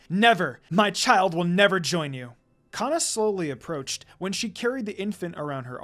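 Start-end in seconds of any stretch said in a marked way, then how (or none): 0.1-2.3 fierce loud refusal; loud growl. A narrator's reading. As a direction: bellow it in defiance. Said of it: "She growled at him loudly".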